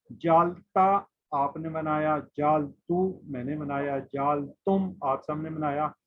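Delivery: "जाला उसने बनाया" read slowly and clearly, each word spaced; slow, deliberate teacher drill; slow drill repetition. Shown as a demonstration. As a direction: recite the forms slowly for the class to repeat. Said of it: "repeat करता हूँ".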